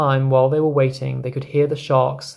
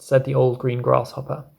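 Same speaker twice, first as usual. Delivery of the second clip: ordinary delivery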